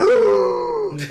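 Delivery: sharp, high gasp of fright; a sudden sucked-in gasp of shock; startled gasp. Retold or imitated. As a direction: gasp like the car just missed you. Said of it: "I went".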